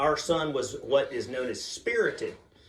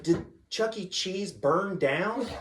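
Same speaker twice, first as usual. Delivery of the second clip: put-on high, drawn-out child's question; distressed little daughter; 5 semitones higher, much slower than usual. Daughter asks dad about burned building, high worried voice. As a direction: imitate a worried child, pitched up.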